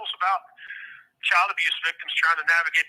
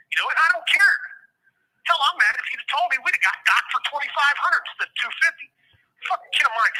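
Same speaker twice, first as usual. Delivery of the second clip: exasperated rant; 8 semitones higher than usual. Real, not acted. Father on a prison call venting at his son, exasperated. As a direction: let the frustration burst out. Ranting at son over money.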